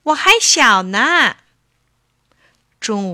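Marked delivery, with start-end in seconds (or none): 0.0-1.4 higher, louder spoiled-kitten voice; coquettish childlike pleading. A narrator's reading. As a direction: act cute to get out of it. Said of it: "小猫咪撒娇说".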